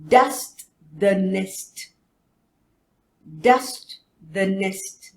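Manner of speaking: Dust the nest spoken very slowly; slow teacher modelling; slow model sentence. Shown as a demonstration. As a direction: stretch each word for the class. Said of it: "repeat after".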